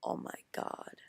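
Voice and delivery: hushed whispered dread; whispered horror at the screen. Retold or imitated. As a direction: breathe it out in a whisper. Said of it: "And I'm like".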